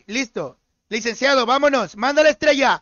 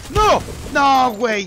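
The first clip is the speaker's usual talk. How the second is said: frustrated outburst; 4 dB louder, slower than usual. Real, not acted. Reacting to dying in game.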